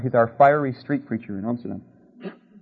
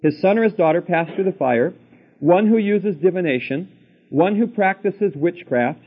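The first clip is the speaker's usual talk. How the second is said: slow, higher, solemn reading voice; scripture reading; 4 semitones higher, 3 dB louder than usual. Acted. Preacher reading Deuteronomy to his listeners, slow solemn scripture voice. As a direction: read it out like holy writ, word by word. Reads scripture list aloud.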